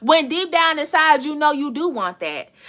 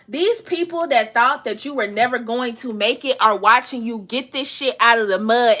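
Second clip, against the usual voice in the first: ordinary talk, nothing marked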